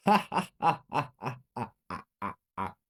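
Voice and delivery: fading villain cackle; cackle, then let it die away; evil laugh. A narrator's reading. Mother over the fallen teacher, evil laugh. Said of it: "started laughing".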